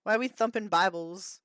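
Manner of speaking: ordinary delivery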